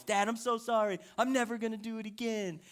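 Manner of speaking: mildly whiny mock apology from a kid; lightly voiced sulky child; imitating pouting child. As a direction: plead like a pouting daughter. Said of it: "pouts the whole way".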